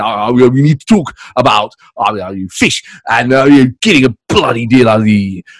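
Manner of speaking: lightly voiced blustering Boris; a hint of Boris rambling; Boris impression. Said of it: "and then we'll flip it".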